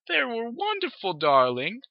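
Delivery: high, airy, sugary darling; posh mom voice. Acted. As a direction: gush like a vain mother. Mother voiced.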